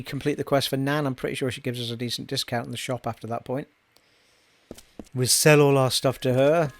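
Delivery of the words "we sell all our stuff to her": slow put-on Scottish accent. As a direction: ham up a Scottish brogue. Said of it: "The best Scottish accent in the world".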